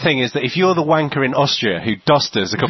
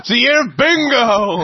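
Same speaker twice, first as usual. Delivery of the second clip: high, loud, slow and drawn out; drawn-out cheer; 10 semitones higher, 4 dB louder, slower than usual. Acted. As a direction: belt it out like a game show host. Drunk banter repeating a line.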